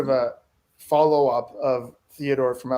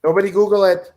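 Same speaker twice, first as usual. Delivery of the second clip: loud warning; 5 semitones higher, 7 dB louder than usual. Real, not acted. Warning players not to cheat.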